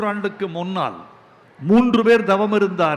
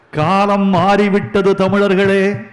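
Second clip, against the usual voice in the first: rousing proclamation; 7 dB louder than usual. Real, not acted. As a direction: call it out to the whole crowd. Rousing close addressed to Tamils.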